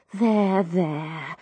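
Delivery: soothing. Acted. Girl soothing the weeping old landlady. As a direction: hush her gently like a child. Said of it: "Muttering soothing inanities".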